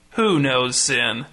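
slow question with WHO drawn out and stressed. A narrator's reading. Pastor repeating his question from the pulpit, stressing "Who". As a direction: lean hard on "Who", let the rest fall. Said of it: "rotating emphasis on each word".

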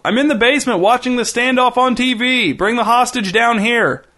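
high-pitched holler from far away; distant calling voice. A narrator's reading. Partner calls back from the basement, distant holler. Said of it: "A distant voice called".

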